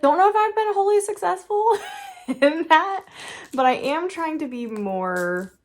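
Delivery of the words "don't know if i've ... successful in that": laughing. Real, not acted. Laughing at own attempt to chill.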